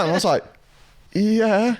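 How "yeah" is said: high, uncertain yeah; hesitant imitated agreement; wary yeah. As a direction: answer as if humouring a madman. Retold wary reply to stranger.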